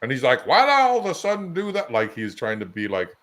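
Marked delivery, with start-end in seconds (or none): 0.5-1.9 high, quick Southern twang; mock Southern accent, pitched way up. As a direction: imitate him slipping into a Southern accent. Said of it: "went right into his Southern accent".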